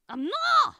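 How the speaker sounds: very loud, high-pitched denial; indignant shout. Real, not acted. Streamer denying being posh, shouting in protest.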